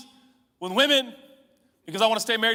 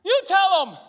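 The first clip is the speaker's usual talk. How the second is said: higher, louder, pleading prayer; loud car prayer; 8 semitones higher, 5 dB louder than usual. Retold or imitated. Preacher reenacting his prayer in the car, loud and pleading. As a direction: cry out to God from the car. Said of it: "I'd be like".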